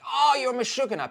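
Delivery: affectionate. Retold or imitated. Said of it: "You're like".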